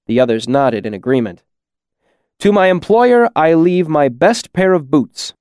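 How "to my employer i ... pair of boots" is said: higher, lightly grand proclamation; magnanimous. A narrator's reading. Bast wills his boots to his employer, magnanimously. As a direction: bestow it with a generous flourish. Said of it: "Bast continued magnanimously".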